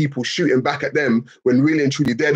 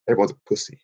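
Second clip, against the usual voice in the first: lower, quiet put-on voice of a smug believer; mock light worshipper; 6 semitones lower, 3 dB quieter, much faster than usual. Mocking a light worshipper to chat, low deadpan voice. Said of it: "They'll be like".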